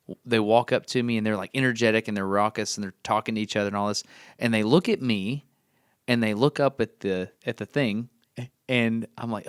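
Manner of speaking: normal talking voice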